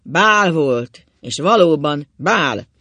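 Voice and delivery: very loud, drawn-out jeer; sarcastic. A narrator's reading. Ákos mocks his wife about the ball, loud and sarcastic. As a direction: punch out each word with scorn. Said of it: "szólt Ákos gúnyosan".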